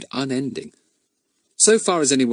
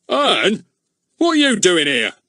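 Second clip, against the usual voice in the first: thundering; 10 semitones higher, 5 dB louder than usual. A narrator's reading. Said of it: "thundered Mister Goon".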